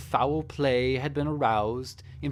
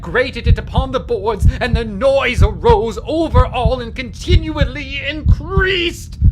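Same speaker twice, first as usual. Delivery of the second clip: building from loud and raised to a high screaming frenzy; a madman's confession swelling from raised voice into unhinged screaming; frantic rising shout; 8 semitones higher, 16 dB louder than usual. Acted. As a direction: let the guilt crack you open, louder and higher to the end. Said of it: "argued about trifles in a high key".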